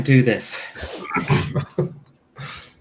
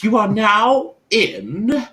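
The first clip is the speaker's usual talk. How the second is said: Margaret Thatcher voice; 9 semitones higher, much slower than usual. Acted. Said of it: "I can't do Margaret Thatcher's voice".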